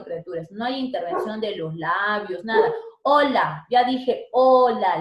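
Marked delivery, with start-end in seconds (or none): 3.0-3.7 Hola loud with a silent h; demo word. Shown as a demonstration. Teacher shows the silent h in Hola. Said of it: "la posición de la O". 3.7-5.0 hola slow and loud, silent h; slow demo. Shown as a demonstration. Teacher shows her students the silent h in hola. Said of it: "la posición de la O".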